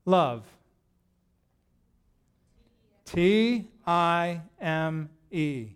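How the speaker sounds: each letter of T I M E said slowly and apart; slow spelling for a congregation; spelled out slowly. Shown as a demonstration. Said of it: "How do kids spell".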